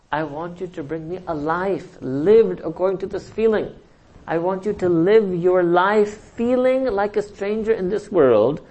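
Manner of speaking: slow, emphatic preaching plea; impassioned exhortation. Acted. Acts out the Prophet's fervent exhortation.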